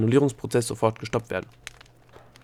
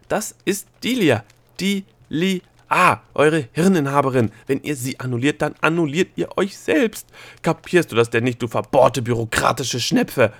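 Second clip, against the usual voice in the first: loud rant jumping high on the name; overwrought shouting; 4 semitones higher, 6 dB louder than usual. A narrator's reading. Gnome berating the stubborn bureaucrat, overwrought. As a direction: shout it with mounting exasperation. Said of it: "mit überschnappender Stimme".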